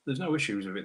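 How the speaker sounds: normal talking voice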